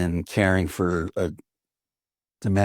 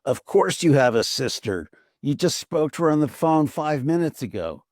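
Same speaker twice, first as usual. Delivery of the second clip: retold screaming; 7 semitones higher than usual. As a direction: imitate an exasperated father, pitched high. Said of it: "once I heard him screaming".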